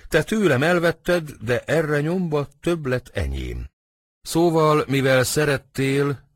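emphatic lovesick grievance; impassioned protest. Acted. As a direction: protest your heartache to a friend. Romeo protests to his friend.

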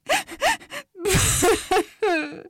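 high, loud acted sobbing; cartoonish whimpering boohoo; sniffling sob. A narrator's reading. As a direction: sniff and hiccup through tears. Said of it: "szipogott és hüppögött egyet".

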